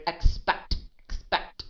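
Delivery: expect punched with a hard, released final t; overemphasized word. Shown as a demonstration. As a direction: overdo the stress on "expect". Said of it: "I don't say it like that".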